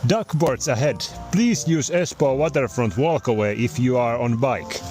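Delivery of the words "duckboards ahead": ordinary delivery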